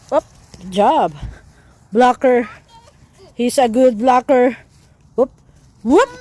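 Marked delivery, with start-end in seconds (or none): none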